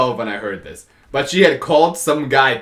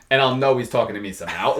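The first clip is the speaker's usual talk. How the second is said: ordinary delivery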